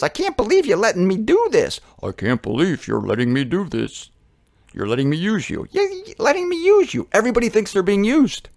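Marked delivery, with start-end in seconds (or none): 0.0-1.8 high, amazed little bird voice; high-pitched character, marvelling; tick bird. Acted. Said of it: "Each one looks at the other". 2.0-5.7 low, amazed rhino voice; lower-pitched character, marvelling. Acted. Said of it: "Each one looks at the other". 5.7-7.1 very high, incredulous bird voice; high-pitched excited tick bird. Acted. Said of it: "Each one looks at the other".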